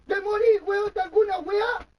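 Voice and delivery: angry shouting. Real, not acted. Arguing about falling ill and dying, angry shouting. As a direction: shout it, stretching the key words.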